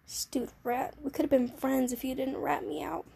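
usual speaking voice

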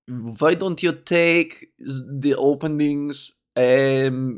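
slow, put-on Russian host accent; mock Russian accent. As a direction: introduce the show as a Russian host. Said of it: "that's as good an accent as I can get".

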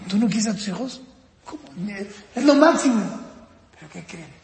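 ordinary talk, nothing marked

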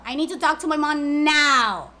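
insist, leaning hard and long on "now"; insistent demand. Retold or imitated. Retells insisting to scam caller.

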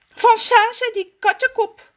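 high, loud complaining voice; sulky Raffie. Acted. Raffie complains to Rommes about Zaza, high. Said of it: "antwoordt Raffie".

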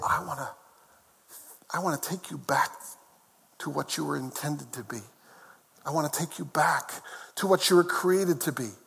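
low, soft and intimate; tender reassurance. Acted. Preacher voices Jesus calling the broken back, softly tender.

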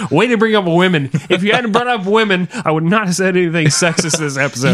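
hammed-up indignant scolding; mock blame. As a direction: turn it on your friend in jest. Mock blaming friend for the sexism.

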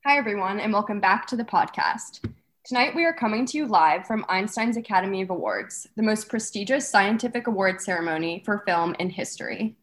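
loud, bubbly red carpet host; gushing red carpet chatter. Acted. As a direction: gush like an awards show host.

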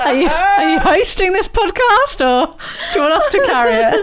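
high laughing mock call; laughing tease. Real, not acted. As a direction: tease them while laughing. Teasing cohost, laughing.

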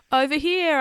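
high, loud call; shout. Acted. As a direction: call out to draw them over. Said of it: "I shouted".

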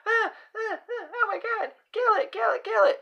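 very high, squealing panic; mock freak-out. Imitating women who see a mouse, mock freak-out. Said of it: "they just go into freak out mode".

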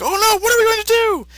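very high, whiny mock panic; panicky squeaky voice. Goku and Kaioshin panicking, in a high squeaky voice. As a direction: squeak it out like a frightened kid. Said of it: "Goku and Kaioshin are like".

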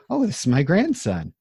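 acted grandma. Acting out grandmother introducing her grandson.

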